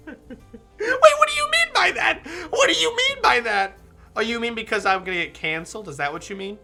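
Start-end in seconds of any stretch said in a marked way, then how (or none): 0.1-3.8 high laughing indignant squeal. Real, not acted. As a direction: laugh out mock outrage. Mock offence at chat comment.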